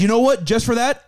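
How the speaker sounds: drill sergeant. Retold or imitated. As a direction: hand out punishment like a sergeant. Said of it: "drill sergeant looking dude up front".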